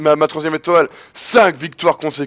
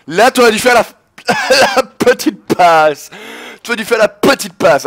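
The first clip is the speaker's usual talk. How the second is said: loud, high, drawn-out reproach; exasperated shouting; 5 semitones higher, 4 dB louder than usual. Real, not acted. Gamer scolding teammate over the missed little pass, exasperated shouting. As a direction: yell it higher and stretch out the words.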